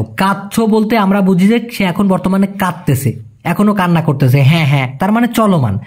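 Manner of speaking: ordinary talk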